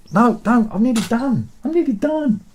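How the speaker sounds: high, insistent reassurance that it's almost over; high-pitched pleading. Real, not acted. Breaks off to answer an interruption.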